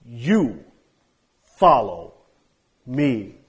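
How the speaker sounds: loud, each word punched apart; emphatic command. Shown as a demonstration. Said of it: "Stated with emphasis".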